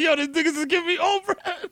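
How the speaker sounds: very high outraged whine of complaint; livid aunt. Retold or imitated. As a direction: imitate an aunt who won't stop complaining. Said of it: "she just kept saying".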